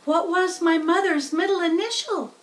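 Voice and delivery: curious little spider; second spider voice. A narrator's reading. Second spider asks Wilbur, high and curious. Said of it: "asked the second spider".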